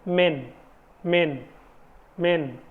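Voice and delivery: मेन with a short open e; short e demo. Shown as a demonstration. Teacher repeats मेन for students, short e. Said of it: "ए sound में".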